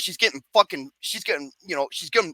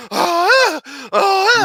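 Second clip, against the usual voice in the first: high imitated moans; hammed-up breath-out "ah" of pleasure; mock orgasmic moans; 9 semitones higher than usual. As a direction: moan twice in exaggerated imitation. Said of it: "making these orgasmic sounds".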